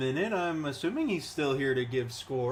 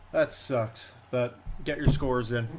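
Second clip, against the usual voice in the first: usual speaking voice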